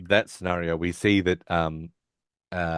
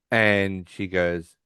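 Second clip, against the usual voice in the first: normal talking voice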